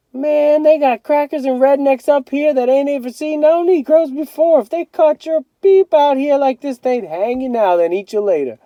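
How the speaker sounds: high, loud, spooky tale-telling; scary-story older brother. A narrator's reading. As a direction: spook the kid brother on purpose. Brother scares little brother.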